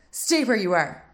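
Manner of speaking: put-on the startled boy orders her to stay put; boy crying out. A narrator's reading. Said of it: "he cried".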